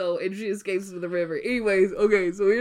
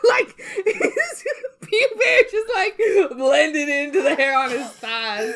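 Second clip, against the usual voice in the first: laughing; 5 semitones higher, 5 dB louder than usual. Real, not acted. Laughing through story.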